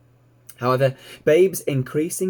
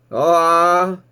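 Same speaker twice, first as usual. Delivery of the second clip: put-on slow, louder weary old sheep complaining; grumbling old ewe; 6 semitones higher, 9 dB louder than usual. A narrator's reading. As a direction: groan it out like a reluctant old ewe. Ma sighs.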